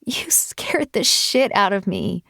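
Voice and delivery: slow, whispered, out of breath; breathless. Acted. Woman to the man who hid in her car, breathless whisper. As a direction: gasp it out between breaths after laughing. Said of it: "I spoke through my breathlessness".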